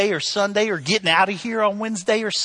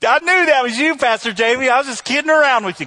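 loud, high, hurried mock excuse; hammed-up cover-up to the pastor; sheepish backpedal; 8 semitones higher, 8 dB louder than usual. Caught honker backpedals to pastor.

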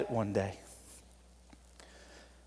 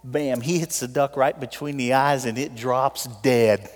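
usual speaking voice

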